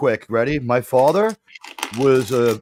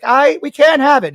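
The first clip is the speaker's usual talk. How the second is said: firm mother; 9 semitones higher, 6 dB louder than usual. Retold or imitated. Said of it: "She's like".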